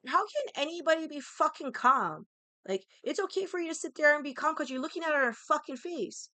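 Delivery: retold distraught protest, high and loud; worked-up, re-enacted bawling protest; upset outburst. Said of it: "So I'm bawling, right? I'm very upset".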